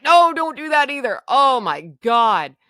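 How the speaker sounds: loud, high outburst slowing into exasperation; alarmed protest. Retold or imitated. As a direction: cry out in retold panic, then drag it out. Acted alarmed protest to son.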